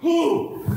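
high sudden yelp of fright; startled whoop. Retold or imitated. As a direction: jump out of your skin and whoop. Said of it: "I went".

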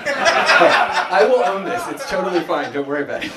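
loud, high, through laughter; laughing. Real, not acted. Speaker brushing off an apology from the audience, laughing.

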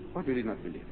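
ordinary talk, nothing marked

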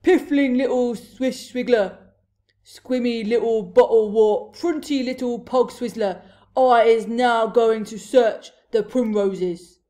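loud giant tirade; shouted abuse. A narrator's reading. Blood Bottler abusing the BFG, shouted tirade. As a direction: bellow each insult in turn. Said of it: "he shouted".

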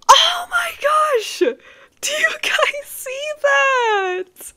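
very high, loud, slow squeal of joy; delighted squeal. Real, not acted. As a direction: squeal like opening the best present. Finds heart necklace, squealing.

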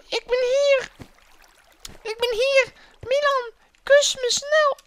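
very high mock damsel call; falsetto princess. Princess beckoning her rescuer, pleading falsetto. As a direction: squeal it in a put-on girly voice.

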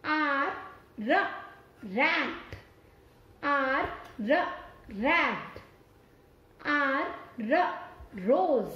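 letter, sound, then the word; teacher drilling R words; phonics word drill. Shown as a demonstration. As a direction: sound out आर, र, रैट for kids. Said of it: "अब हम next letter देखते हैं आर".

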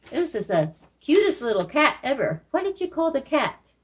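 ordinary talk, nothing marked

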